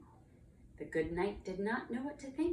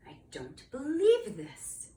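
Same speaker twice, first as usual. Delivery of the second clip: slow, higher, louder disbelief; exasperated knight; 7 semitones higher, 5 dB louder, slower than usual. Acted. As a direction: draw it out in exasperation. Knight exasperated by another roar.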